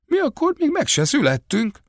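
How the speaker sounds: very high-pitched protest; high, aggrieved retort; indignant protest. A narrator's reading. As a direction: protest innocence, pitch shooting right up. Villagers protest their innocence.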